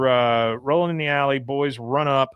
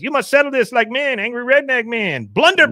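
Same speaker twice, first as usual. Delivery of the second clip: put-on much higher, quick clipped accent; Khan impression; 11 semitones higher, 4 dB louder, faster than usual. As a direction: bark it like Khan. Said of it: "Khan says".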